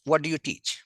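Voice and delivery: usual speaking voice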